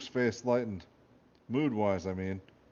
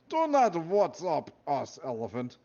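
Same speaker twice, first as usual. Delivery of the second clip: put-on slow, stern guard with a thick Russian accent; Russian guard brushing off Elephant's greeting, in a thick Russian accent; heavy Russian accent; 5 semitones higher, 4 dB louder, slower than usual. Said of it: "said another guard with a heavy Russian accent".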